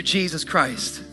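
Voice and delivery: ordinary delivery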